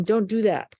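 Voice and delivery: ordinary delivery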